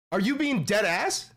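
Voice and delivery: ordinary talk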